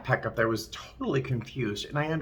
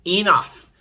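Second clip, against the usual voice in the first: firm loud Enough, falling; stern loud order; loud command; 7 semitones higher, 8 dB louder than usual. Retold or imitated. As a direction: end the fight with one word. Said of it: "I said one loud".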